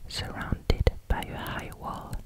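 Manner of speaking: usual speaking voice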